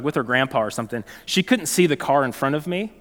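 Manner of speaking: ordinary talk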